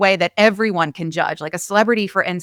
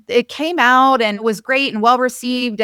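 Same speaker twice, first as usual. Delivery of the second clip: ordinary talk, nothing marked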